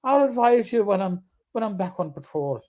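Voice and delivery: lilting put-on Irish brogue; radio call in a playful Irish accent. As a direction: start high and loud in an Irish accent, then settle. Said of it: "I wanted to do one on Saint Patty's Day".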